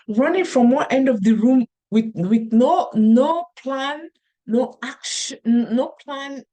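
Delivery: loud, panicky rant of no plan; frantic panic. Pretend. Mother imagining her own panic, loud and frantic. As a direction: rush the words like running round the room in panic. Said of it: "the African in me is screaming".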